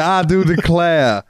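slow, high southern belle "I do declare". Acted. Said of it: "went really southern".

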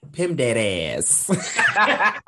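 laughing, loud and slow; laughing shout. Real, not acted. Joking mispronounced PEMDAS.

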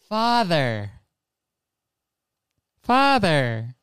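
lightly mimicked trailer puppet voice; a hint of a cartoon boy calling father; Pinocchio. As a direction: parrot the film line, drawing it out. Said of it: "confused over the voice that they've chosen".

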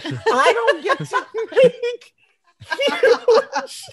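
high, slow words squeezed through laughter; laughing hard. Real, not acted. Comic protesting she can't decide plot, laughing breaking voice. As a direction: crack up mid-sentence.

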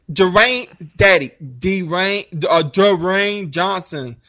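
"Dwayne" drawn out very slowly and haltingly; struggling over the name. Retold or imitated. Dad admitting he mispronounces the name, slow halting "Dwayne". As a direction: stretch out "Dwayne Johnson" slowly, stumbling over it. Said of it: "I know I'm saying the name wrong".

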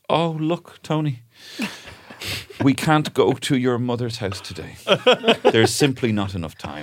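a hint of a child's voice, slowed; lightly voiced little boy. Son making an excuse to his dad, lightly mimicked. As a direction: kid faking regret, lightly played. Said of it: "And he goes".

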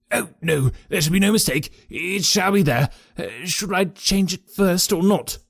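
high, anxious eager promises; cringing. A narrator's reading. Cowed trainer promises Holmes obedience, high and fearful. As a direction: grovel to your new master.

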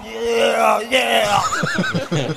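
long drawn-out mock "yeah"; turned-on man. Comic replays his reaction to her noise, drawn-out aroused mock. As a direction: draw it out, acting aroused. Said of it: "I be like".

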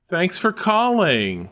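cheery automated greeting; chirpy call-center recording; phone menu voice. Acted. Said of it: "Xfinity gets the, like".

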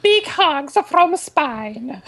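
high, very slow fake Spanish accent. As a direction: stretch it out in a put-on Spanish accent. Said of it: "No, it's Italian".

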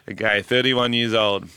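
ordinary delivery

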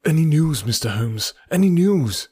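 secretary crying out for news; eager cry. A narrator's reading. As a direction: burst in, desperate for news. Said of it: "he cried".

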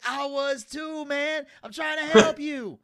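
high voice rising to loud insistence; mock impassioned plea. Skit character trying to help, mock impassioned plea. Acted pleading reply in retold skit.

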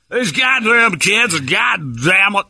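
very high, slow American caricature; hammed-up fake American, loud and high; mock American accent. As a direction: stretch out a silly American accent. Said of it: "do the American accent back".

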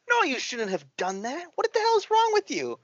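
rising to a high, scolding voice; mock angry scolding. Imitating the angry man scolding the clerk, mock outrage. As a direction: scold her like the furious guy.

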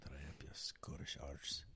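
put-on Scottish accent. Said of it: "stick with your Scottish accent".

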